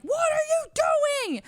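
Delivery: high, loud, drawn-out holler; road-rage yell. Retold or imitated. Angry New York driver yelling through his window, road-rage yell. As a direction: yell it slowly at another car, pitch way up. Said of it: "yelling at us through his window".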